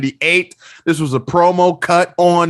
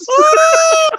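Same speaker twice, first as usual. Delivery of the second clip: loud high laugh; 5 dB louder than usual. Real, not acted.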